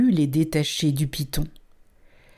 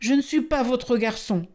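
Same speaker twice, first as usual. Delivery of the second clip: put-on higher, fast, defiant child; defiant retort; 6 semitones higher, faster than usual. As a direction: snap back bravely at the snake. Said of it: "riposta Mowgli en fixant courageusement le serpent".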